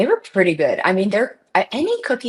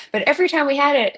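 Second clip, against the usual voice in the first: ordinary delivery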